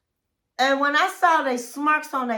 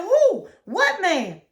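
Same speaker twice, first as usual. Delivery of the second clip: high, loud shocked "Who?"; high, sharp disbelief, retold; mock shocked; 7 semitones higher, 4 dB louder, slower than usual. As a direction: act stunned at the name.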